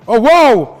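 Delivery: loud, high burst of joy; excited shout. Real, not acted. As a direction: cheer at a big win. Big casino win reaction.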